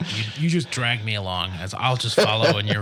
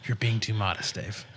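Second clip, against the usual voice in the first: ordinary delivery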